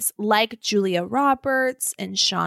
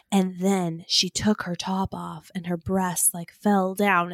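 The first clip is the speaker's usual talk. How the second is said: slow, low, lightly voiced narrator; a hint of a deep movie narrator; Morgan Freeman narration; 6 semitones lower, 3 dB quieter than usual. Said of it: "So Morgan Freeman's, like, saying".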